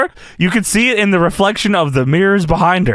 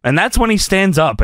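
ordinary delivery

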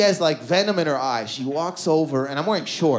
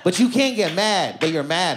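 ordinary talk, nothing marked

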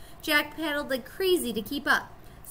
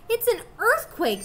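alarmed; 11 semitones higher, 5 dB louder than usual. A narrator's reading. Ground shaking under Jack.

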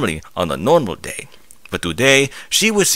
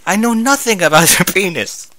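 laughing; 9 semitones higher than usual. Real, not acted. Reader cracks up mid-line.

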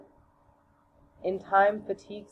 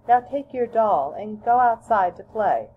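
higher, slightly louder motherly voice; mother's voice; 6 semitones higher, 4 dB louder than usual. Acted. Mother sends her daughter outside to play, lightly higher. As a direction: send the child off to play like a mother.

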